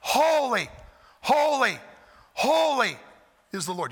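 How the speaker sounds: high, loud holy, holy, holy; crying out. Shown as a demonstration. Preacher voicing angels crying out holy. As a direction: call out each holy to heaven. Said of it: "crying out".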